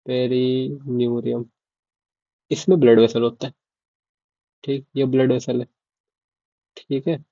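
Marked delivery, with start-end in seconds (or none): 0.1-1.4 perineurium said slowly and softly, syllable by syllable; slow repeat. Shown as a demonstration. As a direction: spell out perineurium slowly for students. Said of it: "क्या बोलते हैं?". (Perineurium धीरे-धीरे, साफ़ दोहराना)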